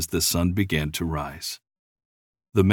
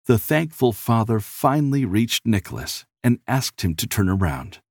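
ordinary delivery, nothing marked

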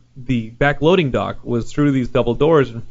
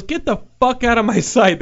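mock furious worker, pitched high; angry high yell; 9 semitones higher than usual. Teamster yelling at Tommy Wiseau, high and furious. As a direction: rant at him like an outraged Teamster. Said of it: "he goes".

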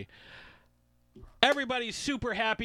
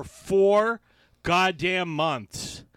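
four much louder, goddamn months slow; emphatic; 8 dB louder, much slower than usual. Real, not acted. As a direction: stretch out the annoyance.

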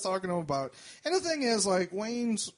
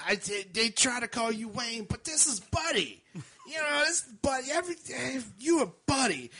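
put-on drunk woman; 6 semitones higher than usual. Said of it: "she points to me and she's like".

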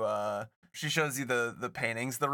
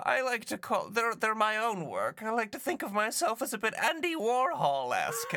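put-on old lady voice; 10 semitones higher than usual. GM voicing rich old lady.

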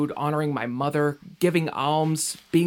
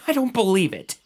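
higher, louder scoffing laugh, then the line; bitter laugh of disbelief; 6 semitones higher, 5 dB louder than usual. Acted. Son scoffs at the guide's faith, laughing in disbelief. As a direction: laugh it off as nonsense.

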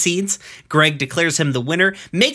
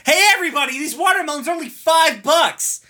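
much higher, calling out to a crowd; 13 semitones higher than usual. Retold or imitated. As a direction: hawk it to everyone nearby. Ronaldo announcing to everybody.

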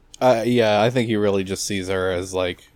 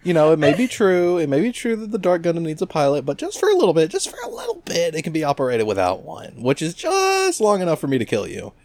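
mock villain voice; 7 semitones higher than usual. Villain quote, higher.

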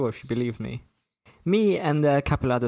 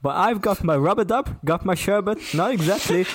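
Cockney cabbie, higher than the narrator; hammed-up, higher-pitched Cockney patter; thick Cockney; 6 semitones higher, 4 dB louder than usual. A narrator's reading. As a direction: rattle off rhyming slang in broad Cockney. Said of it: "a traditional London Cockney".

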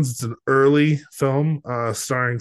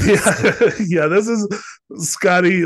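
laughing; 8 semitones higher, 6 dB louder, slower than usual. Real, not acted. Laughing at the funny voices.

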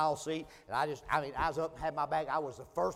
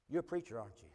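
hushed, much softer question; 5 semitones lower, 7 dB quieter, faster than usual. Retold or imitated. Stranger gently sizing up the preacher, soft and quiet. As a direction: lower your voice as things turn serious. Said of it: "his tone began to change".

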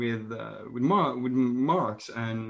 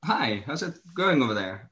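usual speaking voice

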